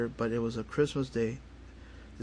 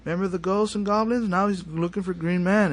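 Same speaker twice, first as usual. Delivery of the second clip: higher, louder teasing taunt; teasing relatives; 7 semitones higher, 6 dB louder than usual. Retold or imitated. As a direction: imitate in-laws gleefully poking fun, pitched up. Relatives teasing him in retold quote.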